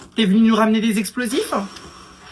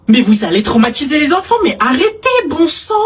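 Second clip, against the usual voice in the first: indignant scolding; 6 semitones higher, 4 dB louder than usual. Acted. Scolding a parent in the park.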